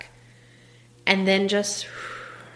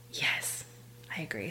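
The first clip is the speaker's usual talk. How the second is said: soft breathy whisper; whispered agreement; 8 semitones lower, 15 dB quieter than usual. Real, not acted. Host agreeing with a viewer comment in a whisper. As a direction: whisper it as a private aside.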